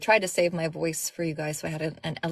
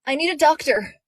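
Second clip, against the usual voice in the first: urgent call; 10 semitones higher, 6 dB louder than usual. Acted. Urgent demand from narrator character.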